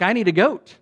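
ordinary talk, nothing marked

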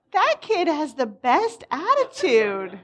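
high, loud, drawn-out praise; enthusiastic admiration. Retold or imitated. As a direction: gush slowly and emphatically over the kid. Her admiring reaction to the kid.

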